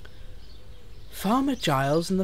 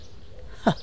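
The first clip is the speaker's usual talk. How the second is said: guffaw; 5 dB quieter than usual. A narrator's reading. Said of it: "he guffawed".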